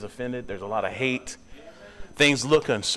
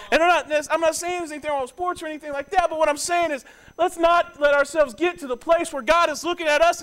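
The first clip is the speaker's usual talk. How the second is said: much higher, louder preacher's cadence; full-force preaching; 13 semitones higher, 4 dB louder than usual. Acted. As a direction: plead with the congregation from the pulpit.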